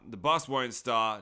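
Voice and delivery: ordinary delivery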